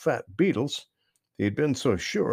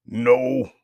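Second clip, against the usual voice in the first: grumpy short no, louder; a sleepy toad grumbles; 6 dB louder than usual. Acted. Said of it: "said old Mister Toad grumpily".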